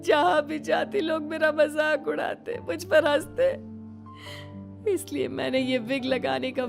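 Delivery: high, sobbing lament; tearful outpouring. Acted. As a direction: pour out old hurt through sobs. Tearful confession of mockery suffered.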